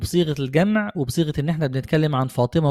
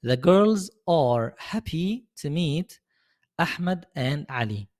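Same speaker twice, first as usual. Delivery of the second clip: slow model sentence; much slower than usual. Shown as a demonstration. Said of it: "هنقولها إزاي؟".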